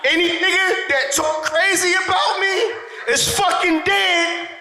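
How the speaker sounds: high-pitched ranting gangster threat; Mitch from Paid in Full. Acted. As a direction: threaten like a worked-up street hustler. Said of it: "I went full Mitch from Paid in Full".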